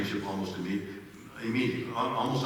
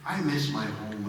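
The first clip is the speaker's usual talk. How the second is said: ordinary talk, nothing marked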